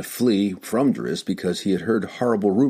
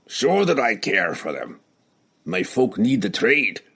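gruff dwarf voice; 2 semitones higher than usual. Acted. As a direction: admit it gruffly. Dwarf admits grudgingly.

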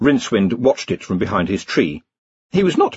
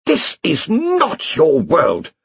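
slow, high, menacing hiss; hissing queen; 9 semitones higher, much slower than usual. A narrator's reading. Elf queen warning Rincewind, slow menacing hiss. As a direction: hiss it slowly like a threat. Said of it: "the Elf Queen hissed".

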